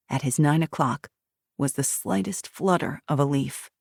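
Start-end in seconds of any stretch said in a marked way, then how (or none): none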